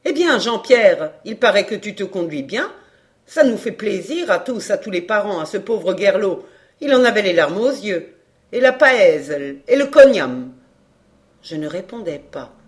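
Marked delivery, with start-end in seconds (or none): 0.1-10.5 loud, brash fishwife chatter; shouting market woman. Acted. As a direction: hail the whole family at market volume. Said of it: "cria-t-elle en me voyant". (verve criarde de marchande de poissons)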